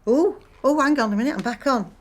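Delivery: flustered as the line goes live; flustered excitement. Real, not acted. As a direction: scramble back to the phone all of a flutter. Call finally picked up.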